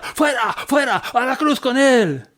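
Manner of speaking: high, slow shouted chant; mob shouting. Acted. Crowd shouting at Pilate for the cross, high slow yell. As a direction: roar it like a furious crowd. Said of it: "no paraban de gritar".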